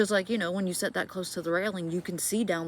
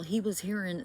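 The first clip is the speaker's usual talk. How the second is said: ordinary delivery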